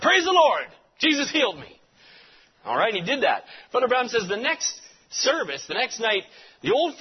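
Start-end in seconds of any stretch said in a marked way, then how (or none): 0.0-1.8 high, loud shout of praise; healed blind man hollering his praise; joyful holler. Retold or imitated. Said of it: "he hollered".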